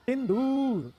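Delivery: ordinary delivery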